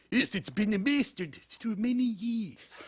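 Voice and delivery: high, slow Chinese accent; heavy Chinese accent, slowly. Shown as a demonstration. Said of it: "my Chinese accent is".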